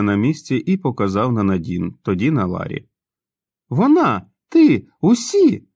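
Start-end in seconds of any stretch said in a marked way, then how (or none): 3.7-5.7 excited shout. A narrator's reading. Joe pointing, shouting.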